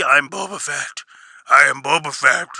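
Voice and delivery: lightly mocked repetition of "I'm Boba Fett" in a put-on voice; a hint of a self-important bounty hunter. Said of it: "Bro, you're telling everybody who you are".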